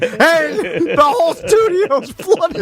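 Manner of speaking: high, drawn-out teasing imitation; lightly hammed-up announcement; mock cheerful report. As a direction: stretch it out while laughing. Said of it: "you're mad because you were, like, so calm".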